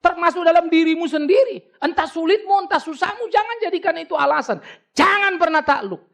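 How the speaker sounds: high, ringing exhortation at full force; fiery preaching. Acted. Pastor urging the congregation not to fear, high and ringing. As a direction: lift the congregation, pitch high and urgent.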